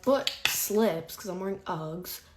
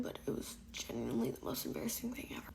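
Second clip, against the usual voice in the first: whispering; 3 semitones higher, 10 dB quieter, faster than usual. Real, not acted. Confessional aside.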